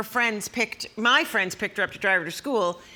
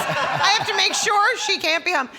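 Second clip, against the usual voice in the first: high, loud, laughing panic; laughing mock fear; 11 semitones higher, 5 dB louder than usual. Mom fearing her daughter will watch, high and laughing. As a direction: squeal it out, half laughing in fake terror. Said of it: "I had genuine fear".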